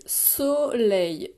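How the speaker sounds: SOLEIL held as two parts, so, then leil; split into syllables. Shown as a demonstration. Teacher counting two syllables in SOLEIL, split syllable by syllable. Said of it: "deux syllabes".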